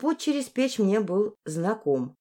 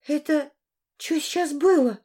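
put-on high-pitched, dumbfounded girl; stunned question; 8 semitones higher than usual. A narrator's reading. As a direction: ask it stunned, pitched well up. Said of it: "ошеломлённо спросила Арина".

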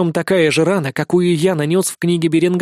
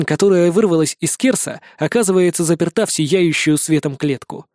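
ordinary delivery, nothing marked

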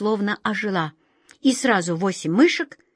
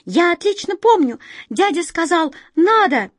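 high, emphatic mouse; loud high piping at the end; mouse squeak; 9 semitones higher, 5 dB louder than usual. A narrator's reading. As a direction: insist in a squeaky little voice. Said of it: "пропищала самая толстая мышь".

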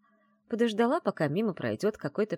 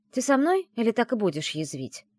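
higher, mildly annoyed question; frowning challenge; 7 semitones higher, 4 dB louder than usual. A narrator's reading. Heroine challenging her sniping friend, frowning annoyance. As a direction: ask it impatiently, with a frown. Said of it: "нахмурилась я".

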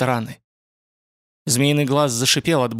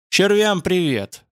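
higher, slow, drawn-out farewell; pitiless send-off; 6 semitones higher, slower than usual. A narrator's reading. Ютланд sends the falling monster off, pitiless. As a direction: call it out slowly, without any pity. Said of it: "без всякой жалости".

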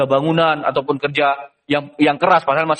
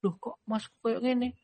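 hushed, much softer tearful question; tearful Yusuf; 6 semitones higher, 14 dB quieter than usual. Acted. Young Yusuf asks his older brothers through tears, hushed. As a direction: ask through tears, barely voiced. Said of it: "Sambil meneteskan air mata".

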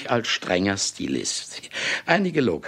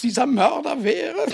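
gloating triumph. Acted. Boasting murderer gloats, laughing.

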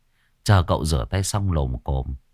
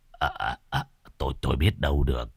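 quiet, hurried stammer; flustered stammer; 7 semitones lower, 7 dB quieter than usual. Acted. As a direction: fumble the words, lower and fast. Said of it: "Môn Phọt lúng túng". (lúng túng lắp bắp)

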